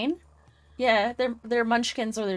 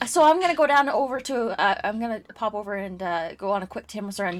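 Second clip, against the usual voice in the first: a light Canadian accent on a Tim's run offer; casual Canadian small-town offer; speaking Canadian. Shown as a demonstration. [name] shows friends how she speaks Canadian when drunk. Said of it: "I started speaking Canadian".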